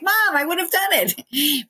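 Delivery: high exasperated mock plea; imitated protest. Acts brother protesting to mother.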